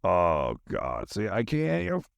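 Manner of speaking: ordinary talk, nothing marked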